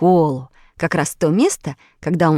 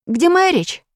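high, worried question; suddenly missing something; anxious startled; 11 semitones higher than usual. A narrator's reading. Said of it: "спохватился Петя".

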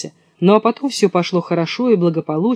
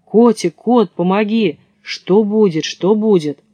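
usual speaking voice

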